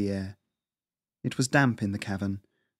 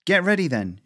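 shouting; 8 semitones higher, 4 dB louder than usual. A narrator's reading. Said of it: "she shouted back".

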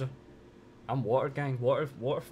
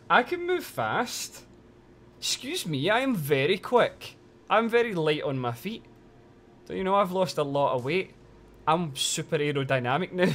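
mock indignant; 5 semitones higher, 5 dB louder than usual. Jokingly defends herself as quick.

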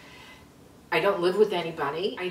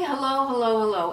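louder calling out; yelling hello; 4 semitones higher, 4 dB louder, faster than usual. Retold or imitated. Locked out, yelling hello for help. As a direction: call out hello to the neighbors. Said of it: "So I was yelling".

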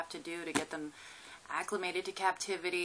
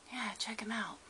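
whispering; 3 semitones higher, 7 dB quieter than usual. Real, not acted. Hushed near lizard.

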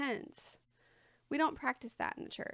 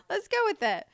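giggled, higher and brighter with laughter; laughing; 9 semitones higher, 4 dB louder than usual. Real, not acted. Teacher laughs at her far-fetched heirloom example, giggling.

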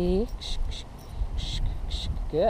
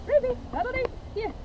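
calling dog; 13 semitones higher, 5 dB quieter, faster than usual. Real, not acted.

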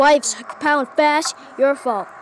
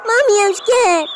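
put-on high, loud frightened kid; mock child crying for mommy; scared child voice; 7 semitones higher, 4 dB louder than usual. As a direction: play a terrified little kid calling mommy.

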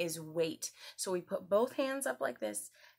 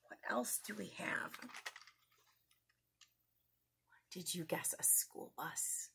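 hushed secretive whisper; 12 dB quieter than usual. Acted. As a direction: lean in and whisper the guess. Secretive guessing game.

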